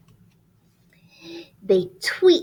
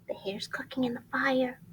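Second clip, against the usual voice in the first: whisper; 9 dB quieter, faster than usual. Real, not acted. Whispering near sleeping bear.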